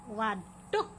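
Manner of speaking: ordinary delivery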